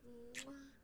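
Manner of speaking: blown air kiss to chat; smacking a kiss into the mic; kiss sound. Real, not acted.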